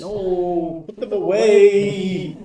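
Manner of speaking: alarmed. Real, not acted. Player panics at new minis.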